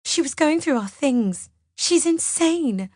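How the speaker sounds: high, wailing complaint; high-pitched distraught wail slowing at the end; tearful accusation. A narrator's reading. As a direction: accuse through gulping tears. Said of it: "She nods and then gulps".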